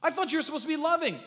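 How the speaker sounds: high, pleading reproach; mock wounded reproach. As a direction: put on a high, hurt plea. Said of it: "she could have said".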